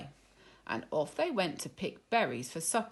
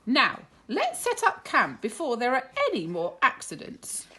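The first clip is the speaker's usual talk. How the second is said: Miss Cluck voice; 7 semitones higher, 6 dB louder than usual. Acted.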